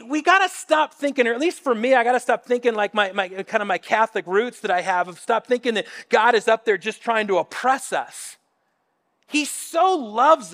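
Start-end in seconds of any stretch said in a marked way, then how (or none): none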